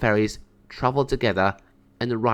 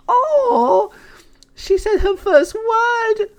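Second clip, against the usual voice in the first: much higher, louder, crying with happiness; tears of joy; 7 dB louder, much slower than usual. Acted. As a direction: weep with joy at the first meow. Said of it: "tears of joy".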